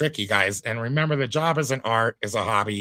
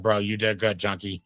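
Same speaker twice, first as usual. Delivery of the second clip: normal talking voice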